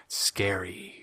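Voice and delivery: hushed spooky whisper; mock spooky whisper. Real, not acted. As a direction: whisper it like a scary tale.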